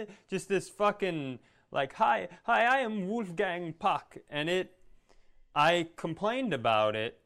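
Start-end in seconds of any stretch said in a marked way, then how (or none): 1.9-4.2 high, drawn-out mock Austrian accent; exaggerated high Austrian-accented voice; Wolfgang Puck. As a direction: do a singsong Austrian chef introduction. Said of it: "started doing the impression".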